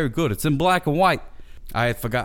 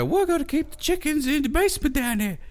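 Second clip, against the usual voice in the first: much higher, put-on squeaky voice; high mock voice. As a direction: squeak it out like a cartoon. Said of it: "So they say".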